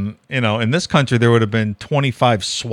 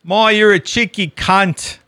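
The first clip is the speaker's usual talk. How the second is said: higher, drawn-out mock Australian; 7 semitones higher, slower than usual. Cohost teasing about Australian slang, mock Australian accent. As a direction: put on a slow, playful Australian accent.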